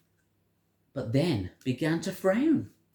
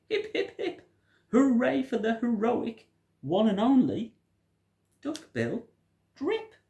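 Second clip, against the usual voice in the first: hooted much higher, a cheer; 11 semitones higher than usual. A narrator's reading. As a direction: cheer for the hero like a duckbill. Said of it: "and they hooted".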